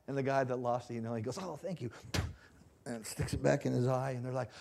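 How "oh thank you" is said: slightly hammed-up, higher-pitched thanks; mock grateful thanks. Player getting his lost contact back, mock grateful. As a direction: lightly imitate a relieved player. Acting out lens owner's thanks.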